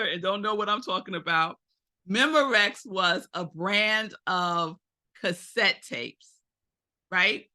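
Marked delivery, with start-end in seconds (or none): none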